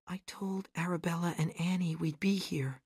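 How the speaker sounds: hushed, whispered confession; quiet. A narrator's reading. Gale confides about Arabella and Annie, quietly. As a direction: whisper it softly, almost voiceless. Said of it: "she said quietly".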